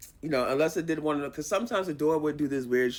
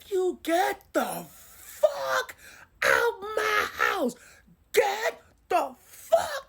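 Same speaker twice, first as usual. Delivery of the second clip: loud, high, drawn-out theatrical bellow; furious roommate; 5 dB louder, much slower than usual. Retold or imitated. Awakened roommate ordering an intruder out of her house, high slow yell. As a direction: stretch each word into a huge angry order.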